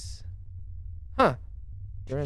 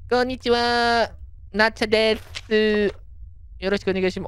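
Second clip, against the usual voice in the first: high visitor voice; 8 semitones higher, faster than usual. Acted. Voicing Japanese visitor.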